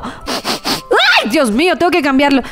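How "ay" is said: grito muy agudo y corto; chillido de susto. Pretend. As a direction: soltar un ay agudísimo de espanto cómico. Descubre el pañal sucio. (al ver que el bebé se hizo popó, chillido)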